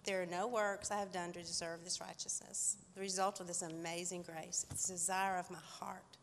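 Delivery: normal talking voice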